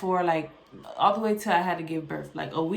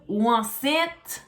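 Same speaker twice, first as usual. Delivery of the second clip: put-on thick Haitian accent, pitched up; 5 semitones higher, 5 dB louder than usual. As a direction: imitate mom's Haitian accent. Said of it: "In Haitian voice".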